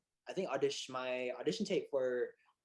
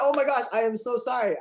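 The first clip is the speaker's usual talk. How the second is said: loud, high, flustered apology; worked-up and apologetic; retold freak-out; 9 semitones higher, 10 dB louder than usual. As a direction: gush the apology, re-enacting your panic. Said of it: "So I freaked out".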